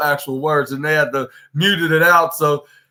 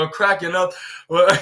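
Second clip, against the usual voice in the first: ordinary delivery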